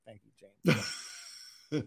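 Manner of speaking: very slow and low; slow deadpan thanks. Acted. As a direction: drag it out, deadpan. Mock thanks to producer.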